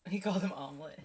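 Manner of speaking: laughing while talking; amused laugh in the words. Real, not acted. Laughing reaction.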